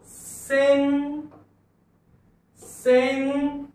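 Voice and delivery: Send very slow, held on a high note; high, drawn-out teaching sound-out of send; broken-up slow pronunciation. Shown as a demonstration. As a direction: stretch send, letting the final ड come separately. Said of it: "फोडून फोडून उच्चार लिहितो आणि हळूहळू".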